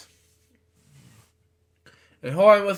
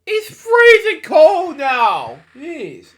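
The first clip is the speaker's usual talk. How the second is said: much higher, louder and slow, hammed-up shiver; freezing cold; 10 semitones higher, 7 dB louder, slower than usual. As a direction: wail it like someone frozen stiff, drawn out. Reader mock-shivers at the cold.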